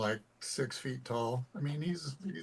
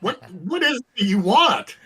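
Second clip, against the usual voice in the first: exasperated; 9 semitones higher, 12 dB louder than usual. Pretend. Mock frustration at game master.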